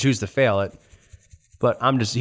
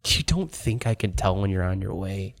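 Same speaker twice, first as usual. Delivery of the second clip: low, understated villain taunt; mild menace; 5 semitones lower, 6 dB quieter than usual. Acted. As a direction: threaten them with quiet restraint, in character. Escaped villain taunts the party.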